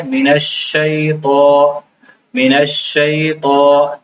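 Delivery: "من الشیطان" slow with a full, heavy ta. Shown as a demonstration. Teacher showing the heavy ta of "من الشیطان". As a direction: thicken the ta in "الشیطان". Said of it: "اس کو موٹا کر کے پڑھنا ہے".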